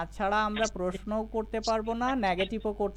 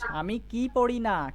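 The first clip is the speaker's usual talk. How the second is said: slow negative question, কি punched and held; drawn-out emphatic কি, falling end; stress on কি; 3 semitones higher, slower than usual. Shown as a demonstration. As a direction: lean hard on কি. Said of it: "কি শব্দের উপরে জোর দিয়ে বলবো".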